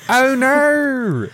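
high, squeaky Aussie "Oh no"; Australian oh no. Retold or imitated. Host imitates an Australian from a TikTok trend, high-pitched. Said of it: "Australians at Home Depot".

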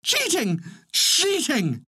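high-pitched squawking duck complaint; crying out. A narrator's reading. Duck waddling off to the pond, high protesting cry. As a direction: squawk it high, each cry falling away.

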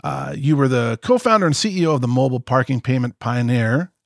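normal talking voice

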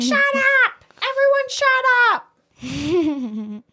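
high, sharp, fed-up mom snapping. Retold or imitated. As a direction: snap at the bickering kids. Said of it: "That's my mom voice".

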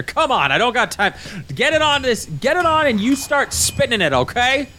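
high, impatient orders; bossy camp cook. Acted. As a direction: bark orders at the newcomers. Orc cook bossing newcomers.

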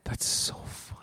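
a breathy, whispered aside; whispering. Real, not acted. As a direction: whisper it under a laugh. Whispered aside while laughing.

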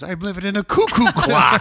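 high, loud cartoon-dad outburst; loud, high mock exclamation; Homer. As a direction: mimic the cartoon outburst, pitched right up. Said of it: "one of my favorite lines is Homer".

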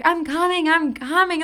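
high-pitched imitation of frantic mom; panicked mom's reply. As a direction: call out urgently as the rushing mom. Mom rushing to panicked daughter.

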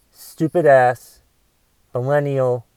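ordinary delivery

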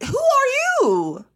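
very high, loud, slow cry of disbelief; furious high-pitched challenge; outraged demand. Pretend. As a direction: shriek it at the stranger. Confronting the rude stranger.

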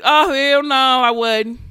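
loud emphatic denial; loud hell-no outburst; loud exclamation. Real, not acted. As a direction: blurt it out loudly in disbelief. Realising the grapes are voodoo.

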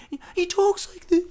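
imitating the antagonist. Host attempts the antagonist's voice himself, high and strained. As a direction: pitch way up and put on the silly voice. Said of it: "he kinda talks like that".

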